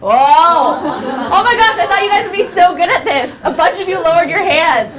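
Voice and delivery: loud, high playful dismay with laughing; mock disappointment. Shown as a demonstration. Teacher catching pupils out, loud playful dismay. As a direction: tease the class for slipping up.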